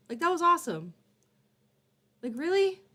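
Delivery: high, loud gushing praise. Real, not acted. Streamer gushing over the guest's confession, excited. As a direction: overflow with genuine enthusiasm.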